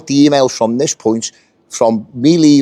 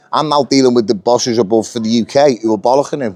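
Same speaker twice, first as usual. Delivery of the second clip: ordinary delivery, nothing marked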